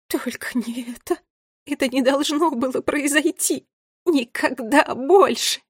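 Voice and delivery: high, distraught tearful lament; tearful trembling. A narrator's reading. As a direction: start weakly, build to a loud wail. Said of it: "пробормотала дрожащим от слёз голосом". (дрожащим от слёз голосом)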